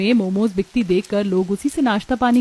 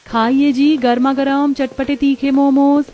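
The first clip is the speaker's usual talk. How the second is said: vendor calling out; 5 semitones higher, 3 dB louder than usual. A narrator's reading.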